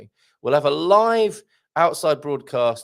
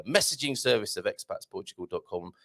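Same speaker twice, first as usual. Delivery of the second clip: ordinary talk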